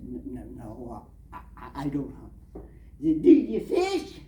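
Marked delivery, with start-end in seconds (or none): 0.0-2.6 soft, stammering reply; flustered mumble. Retold or imitated. Newcomer answering a hunter, soft flustered stammer. 3.2-4.2 louder, higher demand; pushy question. Retold or imitated. Local man quizzing the newcomer, loud pushy question.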